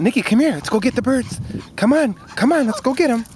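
high sing-song urging to a dog; coaxing dog. Real, not acted. Man urging his dog Nikki toward the ducks, high coaxing. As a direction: egg the dog on, bright and high.

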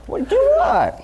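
high, squeaky mock outrage; squeaky angry voice. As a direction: yelp it like an angry cartoon. Acted twin quarrel.

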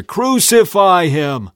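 loud, slow, drawn-out shout; mob roar. A narrator's reading. Mob roaring back at Pilate, drawn-out roar. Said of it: "the mob roared even louder".

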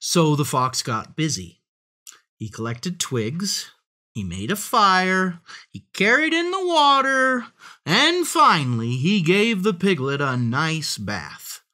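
singsong list climbing in pitch; animated story narration. A narrator's reading. Telling how the fox readies a bath, animated narration.

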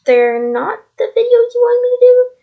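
a retold startled reply to God; incredulous question.

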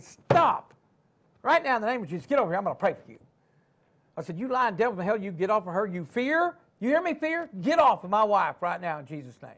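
loud, forceful command; commanding rebuke. Retold or imitated. Husband rebuking the devil off his wife, loud and commanding. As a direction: cast the devil out with authority.

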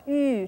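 "U" as French front rounded u; front rounded vowel. Shown as a demonstration. Lecturer demonstrating a French vowel to students, front and rounded. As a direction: say "U" with tongue forward and lips rounded. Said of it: "It's a front rounded vowel".

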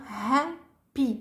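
"happy" broken into two separate beats, HA, then PI; split into syllables. Shown as a demonstration. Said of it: "two syllables". ("happy" مقسّمة إلى مقطعين منفصلين)

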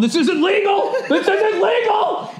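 very high squealed mock outrage; mock frantic protest. Retelling guy dragged into windowless office, mock frantic squeal. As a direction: imitate a helpless man protesting, second cry stretched out. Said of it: "And the guy's going".

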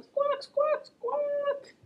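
high squawking bird calls. Acted. Scared birds squawking at the scarecrow, high squawks. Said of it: "and they're going".

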